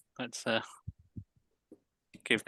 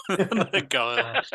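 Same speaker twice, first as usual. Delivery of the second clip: laughing; 12 semitones higher, 4 dB louder, much slower than usual. Real, not acted. Repeating a silly pronunciation.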